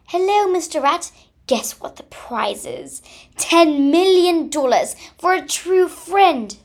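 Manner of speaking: high, loud joyful squeal with a whispered middle. Acted. Squirrel squeals his big news to Mister Rat with joy. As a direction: squeal it out like the best news ever. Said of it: "squealed Mister Ra-, Squirrel in joy".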